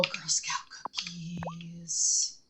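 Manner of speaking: slow breathy whisper of awe. Real, not acted. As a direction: hush it out slowly, amazed. Gasps at cookie question.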